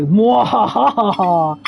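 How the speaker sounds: slow evil mwahaha; sneering panto villain laugh. Pretend. Gloating over getting to vote.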